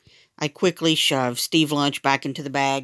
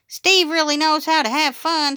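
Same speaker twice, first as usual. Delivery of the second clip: put-on excited kid; 12 semitones higher, 4 dB louder than usual. A narrator's reading. Excited kids praise Steve.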